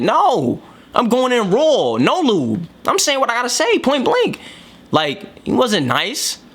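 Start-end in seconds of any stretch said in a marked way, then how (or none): 0.0-4.4 emphatic, higher-pitched bluntness; mock-defiant voicing, pitch climbing to the end; blunt refusal. Voicing Jesus refusing to step on eggshells, blunt refusal.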